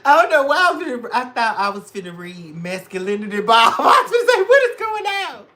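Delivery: very high, loud laughing talk; cackling while talking; laughing shriek. Real, not acted. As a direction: squeal through the laughter.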